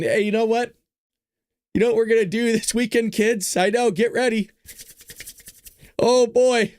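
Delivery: high, eager dad voice pitching a family trip; excited dad. Retold or imitated. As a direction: hype the kids up like a proud father. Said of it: "who's asking".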